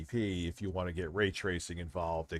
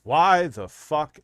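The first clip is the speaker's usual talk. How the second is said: exasperated outburst; 8 semitones higher, 11 dB louder, much slower than usual. Real, not acted. Outraged rant at adults baiting.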